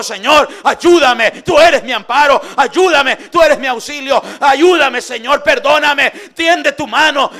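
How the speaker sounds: loud, high pleading cry to God; Jeremías crying out to God from the cistern, loud pleading; desperate loud prayer. Acted. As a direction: beg the Lord for help at full voice. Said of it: "Él allí clamó al Señor y le dijo".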